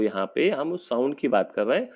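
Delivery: normal talking voice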